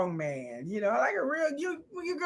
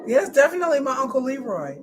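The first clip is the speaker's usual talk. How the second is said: usual speaking voice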